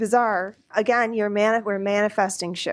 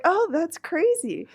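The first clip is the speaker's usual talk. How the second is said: surprised; 9 semitones higher than usual. Retold or imitated. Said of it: "And I was like".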